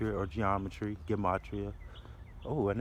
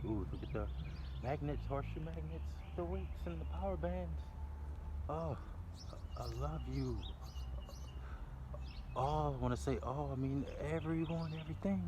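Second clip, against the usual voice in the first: delighted soft; 5 semitones higher, 8 dB quieter than usual. Real, not acted.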